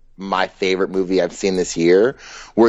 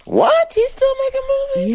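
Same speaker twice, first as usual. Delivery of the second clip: squealing high What? in disbelief; shocked disbelief. Real, not acted. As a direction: jump way up in pitch, stunned. Shocked at Eddie Murphy news.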